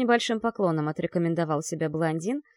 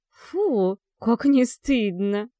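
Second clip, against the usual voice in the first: laughing; 4 semitones higher, 5 dB louder, much slower than usual. Acted. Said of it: "рассмеялась мисс Рид".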